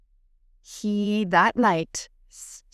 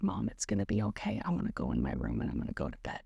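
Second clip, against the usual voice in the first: soft, low imitation of a calm son; quiet reassurance; 13 semitones lower, 8 dB quieter, faster than usual. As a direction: retell it gently and quickly under your breath. Retelling son's calm reassurance.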